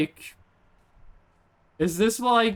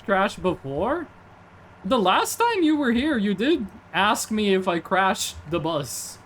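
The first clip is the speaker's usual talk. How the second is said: ordinary talk, nothing marked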